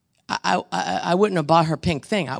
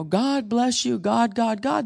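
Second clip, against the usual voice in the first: higher, hammed-up churchy \"God bless you\"; mock pious blessing; 6 semitones higher, slower than usual. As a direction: parody the empty blessing. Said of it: "I wasn't gonna do the".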